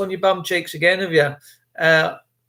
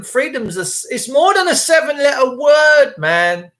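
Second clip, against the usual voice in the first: loud high rant; ranting shout; 5 semitones higher, 4 dB louder than usual. Real, not acted. Ranting at viewers about freedom, loud and high. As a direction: shout it out in anger.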